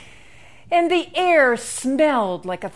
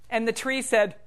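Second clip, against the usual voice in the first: ordinary delivery, nothing marked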